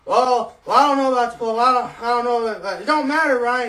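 put-on loud, high drunken rant. Drunk neighbor confronting the narrator, loud and high. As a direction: rail at him like an angry drunk. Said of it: "He gets right in my face".

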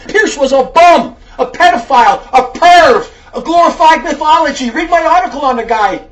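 loud, forceful rebuke with hard-hit words; fiery preaching. Real, not acted. Preacher scolding a letter writer over race and faith, fiery rebuke. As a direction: preach it hard at the wayward listener.

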